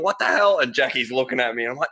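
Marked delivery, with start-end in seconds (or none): 0.0-0.6 confused surprise. Retold or imitated. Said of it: "I'm sitting here going".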